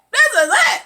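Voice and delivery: high, loud cry of doom; panicked exclamation. Retold or imitated. Viewer sure the heroine is finished, panicked cry. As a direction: cry it out in alarm.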